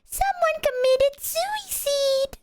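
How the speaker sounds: very high, slow dubbed-woman voice; dub lady voice. Retold or imitated. Imitating the dub lady announcing a death. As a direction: mimic a shrieking dub actress. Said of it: "that lady from the dub".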